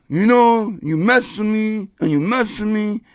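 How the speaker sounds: slow, lightly voiced menacing man; imitated armed threat. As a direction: draw out the threat, lightly acted. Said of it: "the guy literally brandishes a weapon".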